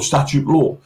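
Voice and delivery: ordinary delivery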